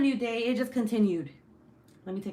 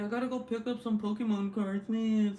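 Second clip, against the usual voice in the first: slightly raised, put-on husband voice; mock husband voice; 3 semitones higher than usual. Husband home from work wanting cards, mock husband voice. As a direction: do your silly husband voiceover. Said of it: "why his voiceover is like that".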